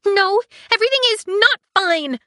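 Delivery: high, loud indignant android; high loud protest; outraged ship AI. Acted. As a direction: wail it as an offended AI. Aiko indignant.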